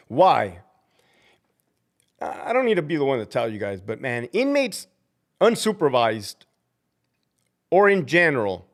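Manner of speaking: usual speaking voice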